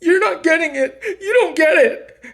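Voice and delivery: mock high falsetto wife voice; imitating ex-wife. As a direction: whine it in a squeaky, put-on falsetto. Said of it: "And then she's like".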